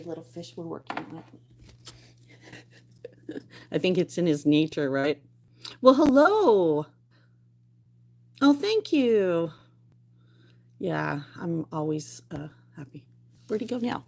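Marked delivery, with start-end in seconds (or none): 5.8-9.5 slow, louder, higher hello; slow sing-song thanks to viewers; drawn-out greeting. Real, not acted. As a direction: stretch the greeting out warmly.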